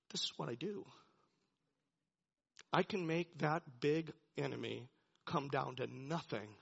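low, soft voice of God; understated divine resolve; quiet authority. Retold or imitated. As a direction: state it with hushed certainty. God speaking with calm authority.

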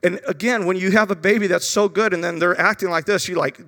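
ordinary talk, nothing marked

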